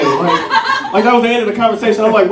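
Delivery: ordinary talk, nothing marked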